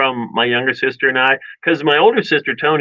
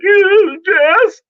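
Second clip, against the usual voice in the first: very high, louder mock squeal of agreement; pained yelp; 4 dB louder than usual. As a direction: imitate squealing yes while in pain. Acting child bent in pain.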